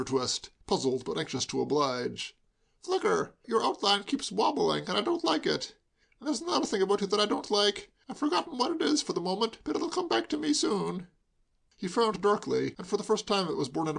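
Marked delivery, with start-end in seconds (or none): 2.8-11.1 tipsy grumbling. A narrator's reading. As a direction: fuss about his blurry guest. Hugo tipsy complaint.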